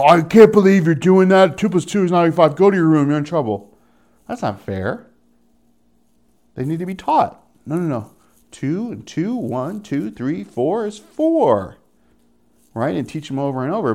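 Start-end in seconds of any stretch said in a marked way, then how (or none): 0.0-3.8 loud, higher-pitched mock telling-off; angry parent scolding. Parent voiced scolding a child. 7.6-11.8 put-on higher, gently counting it out for a child; acted patient correction, last word drawn out; patient parent teaching.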